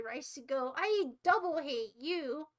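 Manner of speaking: ordinary talk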